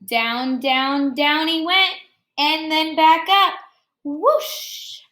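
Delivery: expressive picture-book read-aloud; reading to children. Acted. Teacher reading Jabari Jumps to her class, expressive read-aloud. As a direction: read the story warmly to the class, pausing on each step.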